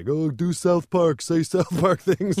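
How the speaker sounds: high, loud, slow jeering; taunting teenager. Retold or imitated. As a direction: jeer it high and slowly. Teenagers taunting him, retold.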